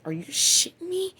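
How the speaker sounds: high incredulous question. Retold or imitated. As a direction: squeak it in disbelief. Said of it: "but I was like".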